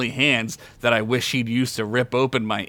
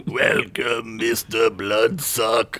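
slow, booming villain welcome; booming menacing voice; 2 semitones lower, much slower than usual. Acted. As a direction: loom over him and greet him darkly. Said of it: "a booming and menacing voice".

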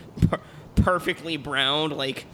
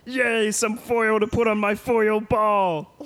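put-on high, slow gleeful man-child; Pee-wee Herman voice; 7 semitones higher, 4 dB louder, slower than usual. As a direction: squeal with childish delight. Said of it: "Pee-wee's like".

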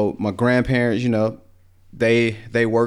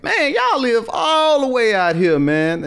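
mocking friends' joke; 13 semitones higher than usual. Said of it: "everybody used to have jokes like".

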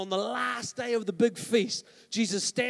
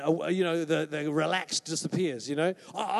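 ordinary talk, nothing marked

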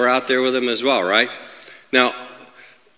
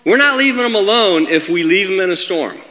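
high, emphatic, raised-voice sermon; full-force preaching; 10 semitones higher, 3 dB louder than usual. Real, not acted. Preacher assures congregation God has them, forceful preaching. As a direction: proclaim it phrase by phrase with conviction.

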